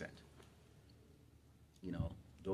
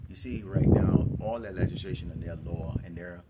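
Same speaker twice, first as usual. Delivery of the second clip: louder, driving sermon shout; full-force preaching; 11 dB louder than usual. Acted. Preacher warns the congregation about Trump, loudly preaching. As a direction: preach it hard at the crowd.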